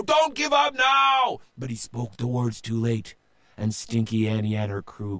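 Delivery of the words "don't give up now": very high loud shout; desperate captain. A narrator's reading. Captain shouting to his crew, desperate. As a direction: stop them giving up. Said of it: "said Joe".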